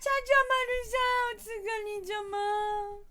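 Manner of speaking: high, loud, delighted hello; excited greeting. Real, not acted. Streamer greets viewer ちゃちゃまるさん, excited high greeting. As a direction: call out happily to a friend.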